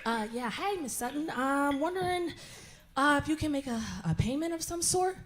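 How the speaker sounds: soft, hesitant caller; hushed, apologetic phone request; timid bill collector. Acted. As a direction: ask meekly, stumbling a little. Acts timid bill collector on call.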